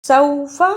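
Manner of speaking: सौफ़ा with an "aw" diphthong; soft-glide sawfa. Shown as a demonstration. Teacher modelling the correct word, सौफ़ा with aw glide. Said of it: "ये हरफे लीन है".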